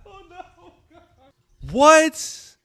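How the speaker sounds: gasping "oh no" through laughter; breathless amused groan; laughing. Real, not acted. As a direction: laugh it out in disbelief. Still laughing at the lyric.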